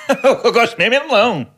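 high-pitched ironic take, breaking into laughter. Shown as a demonstration. Guest redoing his melon line in another tone for the hosts, ironic. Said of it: "Mais irónico ... mais irónico também".

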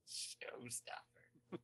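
slow, drawn-out whisper. Real, not acted. Bandmate savouring the fan's verdict, whispered. Echoing showstopper under breath.

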